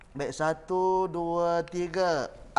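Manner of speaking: loud counted beats then the letter. Shown as a demonstration. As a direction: count satu, dua, tiga in strong beats, then sing out A. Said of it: "kita parking dulu". (kiraan ketukan kuat satu, dua, tiga)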